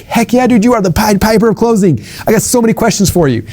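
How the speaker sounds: retold eager yes to a lunch invite; eager fanboy reply. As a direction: gush like a starstruck fan. Retelling his eager reply to Ted.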